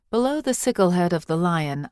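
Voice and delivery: ordinary delivery, nothing marked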